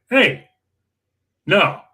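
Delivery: high sharp Hey, then a flat no; blunt warning call; firm retold no. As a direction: stop him cold. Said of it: "I'm like".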